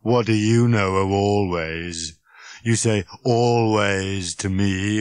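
slow, bitter robot tirade; droning, aggrieved monotone; snapping. Acted. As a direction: drag out the grievance scornfully. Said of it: "snapped Marvin".

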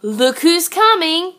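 usual speaking voice

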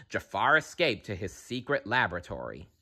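ordinary delivery

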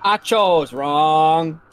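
slow, loud, drawn-out wail; dramatic lament. Real, not acted. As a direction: moan it out theatrically. Dramatic regret after choice.

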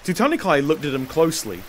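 plain reading voice

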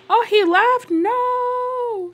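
high, loud dismayed yelp; dismayed cry. Real, not acted. Gamer dismayed as Father Winter leaves without her gift, yelping high and loud. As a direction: wail it as the gift walks out the door.

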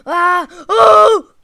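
loud high frustrated screams; raging gamer scream. Real, not acted. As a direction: scream in pure rage. Rage scream after losing.

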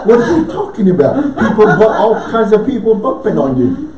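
high, loud, laughing what-are-you-on-about; laughing disbelief. Retold or imitated. Disciples answering Jesus, laughing.

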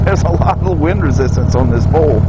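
talking loud over road and wind noise on a moving motorcycle. Real, not acted. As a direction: project the voice over the engine and wind. Talking while riding motorcycle in wind.

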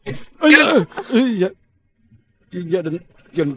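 high, loud flustered outburst; flustered cry when the boss turns to him; startled yelp. Acted. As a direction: blurt it out in a panic. Caught, hurries to answer.

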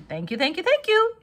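gushing, rising to a high loud squeal; bow and gush; shameless thanks. A narrator's reading. The goose gushes thanks to Charlotte, high and loud. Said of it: "nodding and bowing shamelessly".

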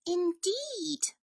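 high, small mouse voice; polite tiny mouse; cheerful mouse. A narrator's reading. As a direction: agree in a little high voice. Cheerful Mouse answers Bear.

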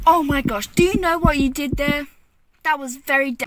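nagging backseat driver. Acted. Scolding after scary stop.

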